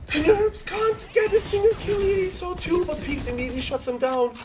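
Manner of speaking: high, anguished play call; excited gasping call. Real, not acted. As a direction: cry out the fall. Gasp at a kill.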